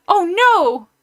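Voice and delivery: sudden high alarmed cry; startled cry. Acted. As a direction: gasp it out in shock.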